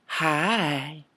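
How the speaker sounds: "Hi" stretched over several syllables in a Southern drawl; long gliding Mississippi "Hi". Retold or imitated. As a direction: greet with an exaggerated Southern drawl. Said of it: "it took three or four syllables".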